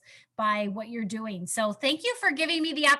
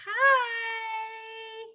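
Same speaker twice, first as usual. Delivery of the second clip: high calling hi; 8 semitones higher than usual. Real, not acted. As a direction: call out to a little one, much higher. Calling to the baby.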